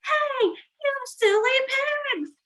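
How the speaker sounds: high, slow, playful yell; mock yell chasing pigs away. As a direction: shout at the silly pigs. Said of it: "and yelled".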